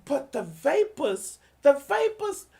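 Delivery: high, shrill mocking falsetto of clutching pearls; mock the prissy hand-wringer way up high; sneering at fake moral panic; mocking fake outrage. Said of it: "fake moral".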